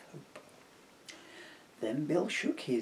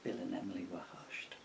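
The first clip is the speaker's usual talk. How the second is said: hushed narration; 6 semitones lower, 9 dB quieter, faster than usual. A narrator's reading. Narration of silence.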